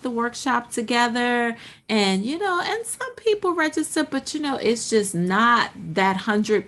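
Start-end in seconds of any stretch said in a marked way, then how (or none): none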